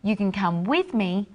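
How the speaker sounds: "with" stressed, as against going alone. Shown as a demonstration. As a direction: make "with" the key word of the line. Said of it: "or I can go by myself".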